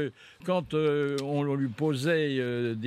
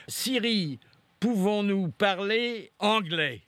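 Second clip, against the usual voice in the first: high, slow, careful command to a phone; talking to Siri; 5 semitones higher than usual. Real, not acted. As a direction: spell it out for the voice assistant. Addressing voice assistant Siri.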